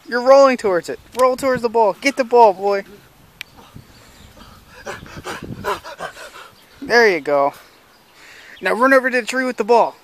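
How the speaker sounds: high, loud calls to a dog; calling from far away. Acted. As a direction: cheer the dog on from afar. Owner calls to dog in yard.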